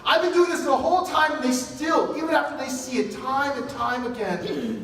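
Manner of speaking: God throwing up his hands over ungrateful people; exasperated God. Retold or imitated. Preacher voicing God complaining to Moses about the people, exasperated. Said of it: "God even told Moses".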